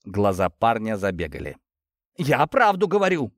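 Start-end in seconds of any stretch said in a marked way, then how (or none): 2.2-3.3 high, anxious outcry; fearful cry. A narrator's reading. Worker insists on truth before the hammer, frightened cry. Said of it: "выкрикнул он с опаской".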